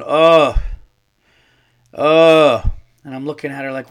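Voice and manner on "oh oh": loud huffing oh, out of breath; mock panting oh. Mocking the winded shelter lady with loud huffing. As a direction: puff it out like she's winded. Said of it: "she's just going".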